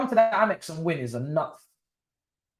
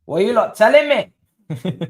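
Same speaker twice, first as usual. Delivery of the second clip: loud, slow, incredulous question to chat; mock outrage at the chat; surprised; 8 semitones higher, 10 dB louder than usual. Real, not acted. Reacting to chat messages.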